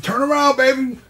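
put-on high, loud old-lady call; southern granny egging him on; big mama voice. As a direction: holler it like a southern grandma. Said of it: "big mama voice".

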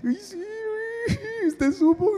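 very high, mock-tearful squeal; seeking pity. Brother angling for his sister's pity, mock crying. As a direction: fake a sob through every word. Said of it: "porque uno busca la lástima".

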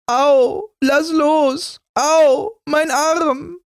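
put-on very high, pained child's cries; crying out in pain. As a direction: yelp like a hurt little girl.

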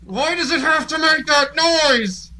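high, slow, frightened whine. Real, not acted. Gamer scared by a creepy noise, slow high whine.

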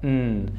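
"N" as a soft hum through the nose; nasal N sound. Shown as a demonstration. Teacher showing N comes from the nose, hummed "nn". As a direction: hum a quiet "nn" for "N".

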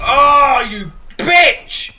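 loud furious outburst; raging gamer. Real, not acted. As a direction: blurt it out in fury. Gamer killed, swears loudly.

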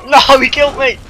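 loud, high battle call to teammates; urgent shouting. Real, not acted. Calling the team onto the big guy, urgent shouting. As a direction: yell orders in a panic.

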